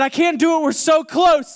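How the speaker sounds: retold shout, desperate and stubborn; shouting back. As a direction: yell back that he's so close. Refusing to pull the plug.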